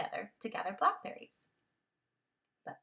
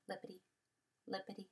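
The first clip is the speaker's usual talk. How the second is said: soft hopping words; 3 semitones lower, 14 dB quieter, much faster than usual. A narrator's reading. Rabbit hopping softly.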